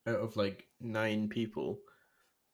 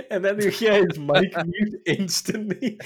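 high, louder, slowed by laughter; laughing while telling; 10 semitones higher, 8 dB louder than usual. Real, not acted. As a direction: tell it while you can't stop laughing. Still laughing as he tells.

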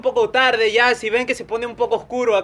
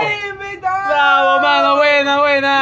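high, drawn-out hurrah; excited cheering; 4 semitones higher, 3 dB louder than usual. Real, not acted. Co-host applauding the new guest microphone, excited cheering. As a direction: cheer the big announcement.

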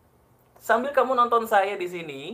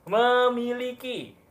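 "Memiliki" stretched out slowly, a bit higher; slow emphasis; 5 semitones higher, much slower than usual. Shown as a demonstration. Coach announcing the next key word to viewers, slow and emphatic. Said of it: "berikutnya apa nih".

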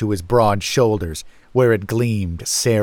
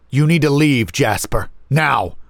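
raised, insistent command; urgent warning; 6 semitones higher than usual. Acted. Older brother telling the younger to run, urgent. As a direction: press them to flee at once. Brother warns of approaching danger.

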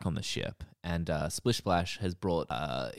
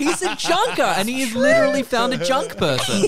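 excited laughing; 9 dB louder than usual. Real, not acted. Excited realisation, laughing loudly.

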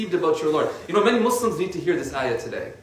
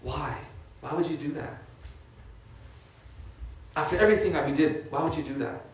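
much softer, hurt and disappointed; pained pleading; 8 dB quieter than usual. Shown as a demonstration. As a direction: ask it quietly like a wounded parent. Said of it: "Another way to address that".

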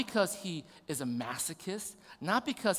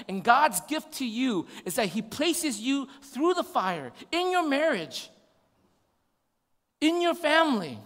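impassioned preaching; 5 semitones higher, 7 dB louder than usual. Acted. Pastor urging church through suffering, impassioned. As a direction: preach it hard, stretching the key words.

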